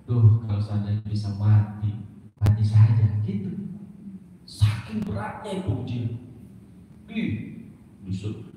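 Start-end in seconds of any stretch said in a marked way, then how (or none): 0.0-3.1 low, mildly anguished lament; understated imitation of a tearful plea; retold weeping. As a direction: voice Maryam's despair in a low register. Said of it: "sambil nangis, Maryam bilang".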